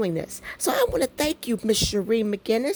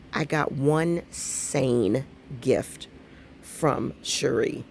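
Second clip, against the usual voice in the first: low, word by word; slow emphatic announcement; 5 semitones lower, much slower than usual. Real, not acted. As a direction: spell it out slowly, each word weighted. Emphatic announcement, then repeated.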